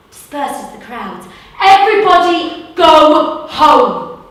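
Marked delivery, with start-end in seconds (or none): 1.6-4.2 commanding call. Acted. Bouncer disperses crowd.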